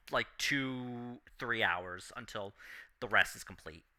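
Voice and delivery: ordinary delivery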